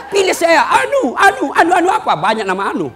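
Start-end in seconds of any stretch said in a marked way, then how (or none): 0.1-0.6 high, loud candidate's plea; campaign shout. Retold or imitated. Candidate begging voters for support, loud campaign shout. 0.7-2.2 high, loud, laughing over the name; laughing mock confusion. Preacher mocking voters who can't recall the caleg, laughing.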